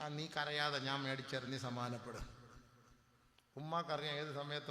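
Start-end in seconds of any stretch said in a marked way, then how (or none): 0.0-2.3 low, soft comforting hush; calm him down gently, lower and softer; soothing mother. Retold or imitated. A mother soothes her crying son, soft and low.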